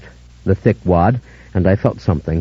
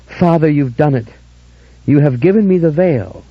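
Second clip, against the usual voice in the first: higher, overjoyed thanks; joyful cry; 5 semitones higher than usual. A narrator's reading. Dora thanking the father with joy. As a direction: pour out joyful gratitude. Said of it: "filled with joy".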